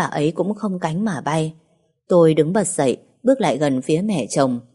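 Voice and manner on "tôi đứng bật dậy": ordinary delivery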